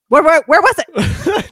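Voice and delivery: a laughing mock-eager psychiatrist pressing for it. Retelling the movie to a friend, a laughing mock-eager question.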